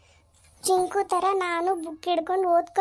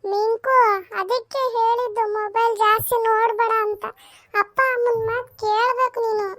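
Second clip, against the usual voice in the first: put-on higher girl's voice chiding her brother; higher-pitched sister lecturing her brother; little sister scolding; 5 semitones higher than usual. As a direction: wag a finger as the younger sister.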